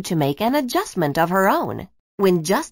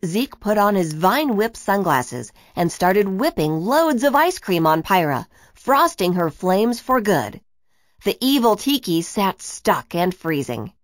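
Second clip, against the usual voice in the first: plain reading voice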